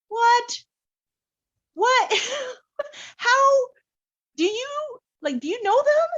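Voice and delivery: very high and loud, half laughing half crying; shocked laughing disbelief. Retold or imitated. She learns they share family ties, shrieking high disbelief. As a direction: total astonishment breaking into laughter.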